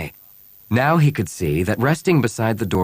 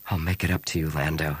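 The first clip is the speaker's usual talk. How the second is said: inner thought; 4 semitones lower, 6 dB quieter than usual. A narrator's reading. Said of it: "he thought".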